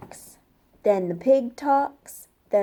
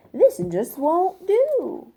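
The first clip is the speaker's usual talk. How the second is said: slow, higher put-on wolf grumble; the wolf fed up after the pig runs off; a sulky storybook wolf, dragging the words; frustrated wolf; 7 semitones higher, 4 dB louder, slower than usual. A narrator's reading. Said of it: "said the wolf".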